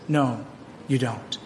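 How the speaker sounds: low, settling answer to the doubters; firm correction. Real, not acted. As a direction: drop low and quiet, decisive.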